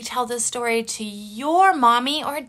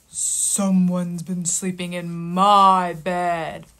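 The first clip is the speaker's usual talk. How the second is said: lower, slow, heavy papa bear voice; gruff Papa Bear; 6 semitones lower, slower than usual. Acted. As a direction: play the big stern father bear. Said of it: "And Papa Bear said".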